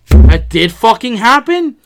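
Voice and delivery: loud, slow, stunned disbelief. Retold or imitated. Hearing the dog died, loud stunned disbelief. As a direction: drag it out in shock. Shock at dog's death news.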